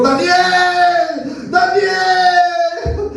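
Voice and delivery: high, long-drawn mournful call; sad crying out. Acted. Preacher as the king calling into the lions' den, sad crying out. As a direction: call out high and slowly, stretching the name. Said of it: "llamó a voces a Daniel con voz triste, o sea, voces a gritos".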